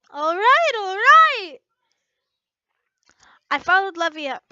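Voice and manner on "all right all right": loud, higher all right all right; exasperated giving in. Acted. Lucy dragged by Levy, loudly giving in. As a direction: give in loudly while being pulled.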